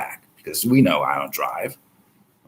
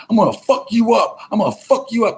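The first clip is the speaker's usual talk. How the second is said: high, mocking voice of an aggressive guy; mock threatening dude; 12 semitones higher than usual. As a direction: play a bus bully threatening, pitched way up.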